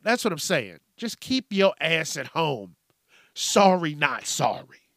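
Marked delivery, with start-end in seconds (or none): none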